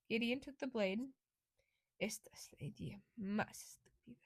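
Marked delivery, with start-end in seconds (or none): 2.0-4.2 hushed, breathless Spanish grumble; whispering. A narrator's reading. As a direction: whisper it under your breath.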